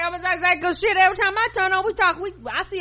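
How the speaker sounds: high, loud, gushing; mock excitement. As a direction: play it up, thrilled by ducks. Joking about animal shows.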